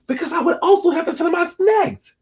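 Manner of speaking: very high squealed admission; high-pitched, comic self-answer; put-on confession. Answers own question in put-on voice.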